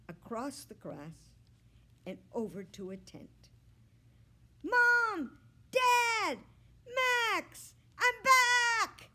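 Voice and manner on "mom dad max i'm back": very high, very loud happy cries; overjoyed calling. A narrator's reading. Fred finding his family at the tent, overjoyed shouts. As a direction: call out with pure joy.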